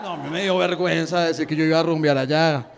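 ordinary delivery

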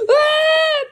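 high, loud cartoon scream; pained scream. Crushed by something heavy, high pained scream.

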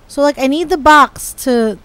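usual speaking voice

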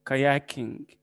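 ordinary delivery

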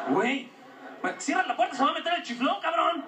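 high mock mother yell; mom yelling. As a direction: shout it like a frantic mom. Mom yelling about draft.

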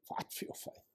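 urgent whisper, no voice; softly whispered echo. Shown as a demonstration. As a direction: breathe it like a secret charge. Repeated whispered.

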